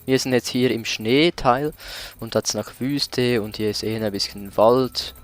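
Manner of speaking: ordinary delivery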